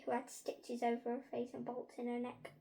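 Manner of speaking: ordinary delivery, nothing marked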